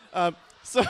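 high, loud burst of laughter; can't keep it in; laughing. Real, not acted. Preacher laughing after teasing the kids.

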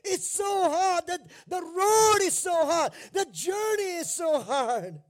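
imitating a member moaning to the pastor, exaggerated whine; mock whining complaint. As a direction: ham up a congregant whining it's too hard. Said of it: "When you ask someone to do".

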